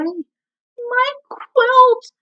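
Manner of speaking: high, alarmed cry. A narrator's reading. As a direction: call out in panic for the lost quilt. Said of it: "cries Quincy".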